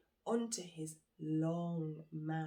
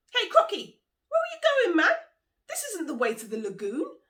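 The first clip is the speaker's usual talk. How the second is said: much higher, louder alarmed shout; alarmed cry; 12 semitones higher, 14 dB louder than usual. Acted. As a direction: panic at the wrong turn. Monkey alarmed at wrong turn.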